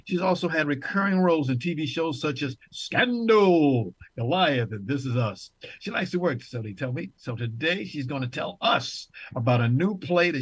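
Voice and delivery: big, swooping talk-show welcome; show host introduction. Acted. Host presenting an actress to the audience, showy announcer introduction.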